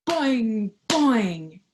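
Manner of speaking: loud boing, boing; bouncing ball sound. Acted. Reading a picture book to children, loud boing sound effect. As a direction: punch out the bounce noise.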